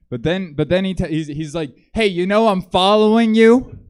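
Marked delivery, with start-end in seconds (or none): 1.9-3.8 much higher and louder hammed-up call; mock a creepy guy calling after her; stalker calling out. Stalker calling out to the girl he follows, loud mock call.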